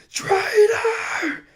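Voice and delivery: imitating an enraged shout; Kylo Ren yelling. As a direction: scream it like betrayed fury. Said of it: "when he goes".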